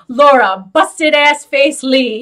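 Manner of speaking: slow, sassy, mock-insulting name drop; a hint of a catty drama host, slow; imitating his nicknames. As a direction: drag out the insult, lightly voiced drama host. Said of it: "Or he will be like".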